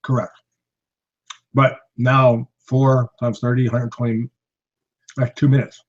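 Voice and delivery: talking while crunching ice; words around a mouthful of ice; chewing ice. Real, not acted. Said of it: "Sorry, I'm chewing on ice".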